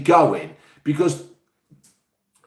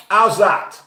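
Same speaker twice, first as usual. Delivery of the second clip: ordinary talk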